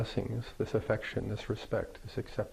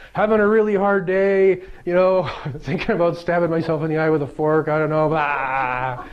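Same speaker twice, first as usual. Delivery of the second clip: mock social-media complaint; 5 semitones higher, 7 dB louder than usual. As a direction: moan it out theatrically, easing down to a blah. Mock social media post seeking prayers.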